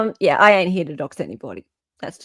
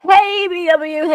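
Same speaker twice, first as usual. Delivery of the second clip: mock cheery greeting; 10 semitones higher, 5 dB louder than usual.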